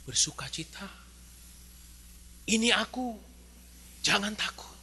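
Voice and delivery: soft, tender voice of comfort; calm the scared ones softly; gentle reassurance. Acted. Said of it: "Tuhan bilang". (suara lembut menenangkan)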